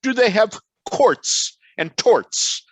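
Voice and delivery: put-on high, slow plummy British accent. Quoting Mary Douglas's question in her plummy British accent. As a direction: mimic a grand English anthropologist in a plummy British accent. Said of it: "in her plummy British accent".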